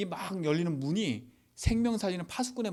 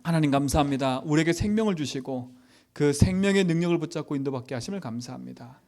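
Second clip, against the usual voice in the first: louder fervent pastor's prayer; 5 dB louder than usual. Acted. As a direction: pray over the congregation with conviction. Closing prayer.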